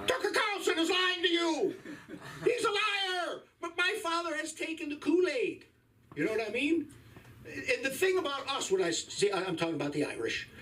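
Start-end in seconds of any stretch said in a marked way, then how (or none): 0.1-5.6 high-pitched exasperation, pleading with a deluded dad; high exasperated plea. Retold or imitated. Son pleading with his father about Tucker Carlson, high exasperated voice. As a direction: squeak it out like a fed-up son.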